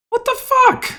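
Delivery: high outraged shriek; shaking with anger. Acted. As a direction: squeal it in disbelief. Outraged outburst continues.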